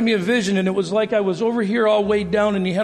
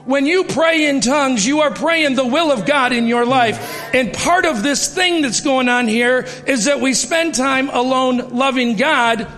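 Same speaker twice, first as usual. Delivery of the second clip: higher, more forceful sermon voice; rising preaching; 4 semitones higher than usual. Acted. Pastor urging the congregation about their equal callings, in a rising preaching voice. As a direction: drive the point home to the congregation.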